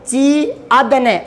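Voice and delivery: teacher to students, slowly spelling out each part; slow spelled-out demo. Shown as a demonstration. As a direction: sound it out slowly, piece by piece. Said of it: "इसको बोलकर देखते हैं".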